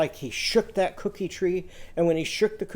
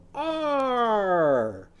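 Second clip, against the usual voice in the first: a higher, effortful arr as if hanging from a bar; straining arr grunt; 5 semitones higher than usual. Shown as a demonstration. Aardvark hanging from the high bar, straining grunt. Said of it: "grab the high bar and go".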